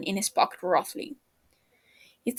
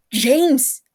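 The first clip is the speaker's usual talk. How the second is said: loud, higher sharp call of a name; yell; 6 semitones higher, 7 dB louder than usual. A narrator's reading. Peter yelling at his panicking friend down the phone. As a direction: snap him out of his panic. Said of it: "Peter yells".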